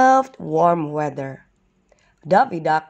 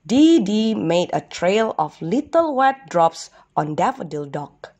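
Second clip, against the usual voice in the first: ordinary delivery, nothing marked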